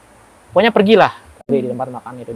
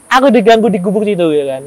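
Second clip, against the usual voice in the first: normal talking voice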